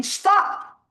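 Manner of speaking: high, loud cried stop; a woman yelling at her attacker, high and loud; imitated yell. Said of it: "And you hear her yelling".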